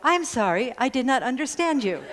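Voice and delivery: light imitation of the assistant answering the parrot; Alexa voice. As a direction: sound like a polite smart speaker. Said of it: "Alexa would go".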